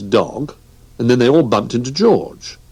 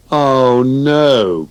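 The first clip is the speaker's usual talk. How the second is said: dismayed oh no; 5 semitones higher, 5 dB louder than usual. Acted. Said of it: "chorused the others".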